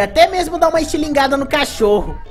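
ordinary talk

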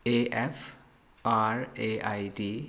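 letters spelled out slowly; slow spelling. Shown as a demonstration. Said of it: "spelling out for class".

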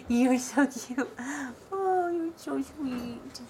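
slow, drawn-out loving coo. Real, not acted. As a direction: stretch the words out lovingly. Slow cooing at puppy.

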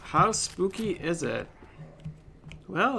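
ordinary talk

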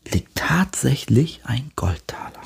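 ordinary delivery

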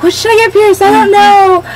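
high-pitched quote. Retold or imitated. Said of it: "I was like".

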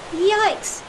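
high, loud cry of alarm; startled yelp. Acted. Pirate spots strange footprints, high startled yelp. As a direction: jump with fright on the word.

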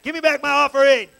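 high, loud, hammed-up outrage; mock angry demand. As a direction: rant like a cheated churchgoer wanting a refund. Acting out churchgoer demanding money back.

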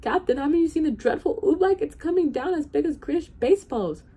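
high, loud alarm; urgent warning. A narrator's reading. Bartholomew warns the unconcerned captain, loudly urgent.

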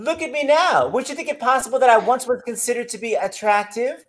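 high-pitched Southern belle as Blanche; lilting Southern stage voice, starting high; Southern accent. Acted. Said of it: "I'll be Blanche".

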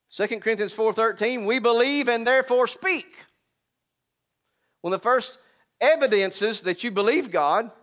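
loud, high preacher's cry; preaching at full force. Acted. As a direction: preach it out to the crowd.

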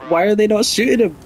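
high, loud, confused disbelief; baffled. Real, not acted. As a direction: ask it completely bewildered. Baffled at teammates not shooting.

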